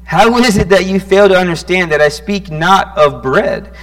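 loud, impassioned scripture reading, voicing Jesus; preaching at full force. Preacher voicing Jesus rebuking the disciples, loud and impassioned.

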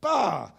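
a loud barking bah. Retold or imitated. Preacher telling mammon who is boss, a loud bah. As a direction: bark it at mammon like at the dog. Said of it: "you are saying to mammon".

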